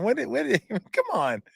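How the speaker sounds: laughing through the words, high and breaking; can't keep a straight face; laughing disbelief. Real, not acted. Laughing at the design.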